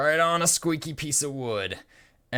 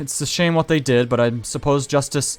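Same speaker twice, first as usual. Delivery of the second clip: higher, louder put-on sigh of regret; fussy old gent voice; 5 semitones higher, 7 dB louder than usual. Jasper distracts the guard, higher and louder. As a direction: play it prim and theatrical. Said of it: "and he says".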